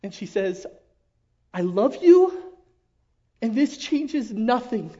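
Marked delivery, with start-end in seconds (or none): 1.5-4.9 louder and higher, slow on the second half; wife's firm declaration. Retold or imitated. Pastor retelling his wife's vow to him, louder and higher. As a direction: plant each word like a vow. Wife's words retold in the crisis.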